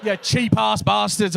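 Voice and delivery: loud, slow jeer at the back rows; playful loud insult to the crowd; mock shout. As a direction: call them out, hammed-up. Heckling people at back.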